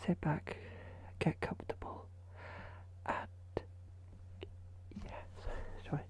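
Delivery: soft fading whisper; sleepy ASMR whisper. Shown as a demonstration. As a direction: coax them to relax in a whisper. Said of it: "I'll try my sleepy voice".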